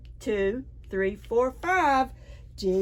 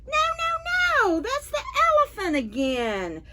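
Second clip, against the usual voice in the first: put-on much higher voice, a child monkey saying no; little monkey protesting. Storyteller to children, as the monkey, high protest.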